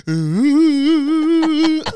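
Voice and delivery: very high, loud fake howl fading to sobs; hammed-up bawling; mock wail. Comic breaking down over who he is, mock wail.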